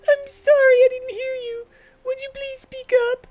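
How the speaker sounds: high, pleading companion; high earnest girl; high party girl voice. Acted. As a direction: ask it like an eager young heroine.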